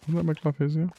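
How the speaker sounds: playful high-pitched aside; high mock question. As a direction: toss the line up high in a teasing rise. Same line repeated, pitched up.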